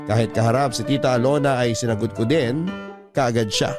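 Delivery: usual speaking voice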